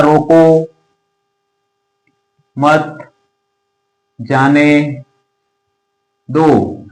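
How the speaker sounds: each word alone with long gaps; isolated words, long pause after each. Shown as a demonstration. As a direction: read it out as if writing it slowly. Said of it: "अभी ऐसा लिखा हुआ है".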